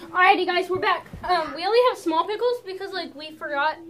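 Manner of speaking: bright, loud host voice; vlog host intro. Acted. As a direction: kick off the video again. Vlog host restarting after the pause.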